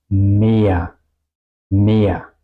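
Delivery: mea with a long, held first vowel. Shown as a demonstration. Teacher models a doubled vowel, long vowel. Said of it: "Por eso suena más larga".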